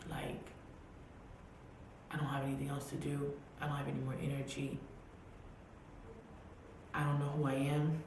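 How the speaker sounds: soft, worn-out murmur; a touch of tearful surrender, soft; crying at the grave. Retold or imitated. At her father's grave, begging God, soft and tearful. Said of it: "crying and saying".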